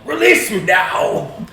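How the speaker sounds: slow, loud, high demand; drawn-out commanding shout; yelling. Acted. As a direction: yell it as a trapped spirit. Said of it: "I'm gonna yell".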